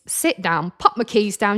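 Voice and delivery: ordinary delivery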